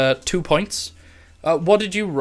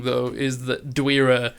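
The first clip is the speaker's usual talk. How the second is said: ordinary talk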